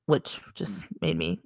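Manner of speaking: soft whispered aside. Real, not acted. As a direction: whisper it under breath.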